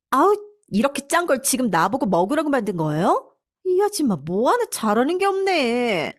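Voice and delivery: high, disgusted complaint; contemptuous daughter-in-law. Acted. Daughter-in-law rejecting housekeeper's salty stew, in high-pitched disgust. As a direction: whine with open contempt, pitch swooping high.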